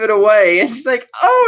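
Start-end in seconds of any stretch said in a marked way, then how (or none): 1.2-1.5 high-pitched delighted exclamation; mock delight. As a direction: let out a high, thrilled little cry. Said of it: "she's all delighted".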